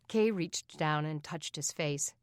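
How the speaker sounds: ordinary delivery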